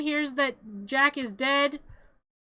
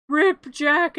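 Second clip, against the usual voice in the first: louder retold megaphone call; mock megaphone announcement; 3 semitones higher, 8 dB louder than usual. Retelling the megaphone news that Jack is dead, mock announcement. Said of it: "talking on his megaphone".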